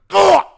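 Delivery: high loud grunt of pain; mock cry when stepped on; winded cry. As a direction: cry out as he steps on you.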